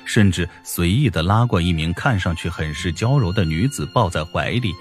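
ordinary delivery, nothing marked

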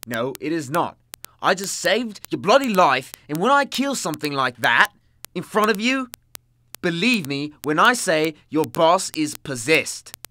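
loud, high, building tirade; heated insistence. Acted. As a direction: hammer it home forcefully. Argues against being called insane.

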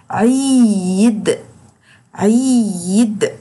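slow عيد with ee held long; long drawn vowel. Shown as a demonstration. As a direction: drill عيد slowly. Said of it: "وبطول في صوته".

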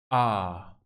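"A" grave y cerrada, a la inglesa; vocal inglesa. Shown as a demonstration. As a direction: suelta la "A" más grave, boca cerrada. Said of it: "pero se hace así". ("A" inglesa más grave)

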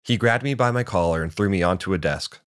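usual speaking voice

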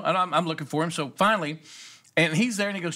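ordinary talk, nothing marked

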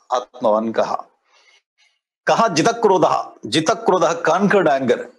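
ordinary talk, nothing marked

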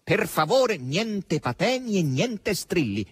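high, drawn-out exasperated warning; clouding over. A narrator's reading. Asasello forbids Margherita any shrieks, displeased. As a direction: warn her off slowly, displeased.

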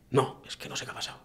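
whispered, lost and confused; baffled whisper. Retold or imitated. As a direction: whisper it like you can't believe it. Hunter baffled after missing shots.

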